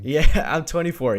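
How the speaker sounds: a real laugh breaking into the answer. Real, not acted. Young guest laughing as he tells his age.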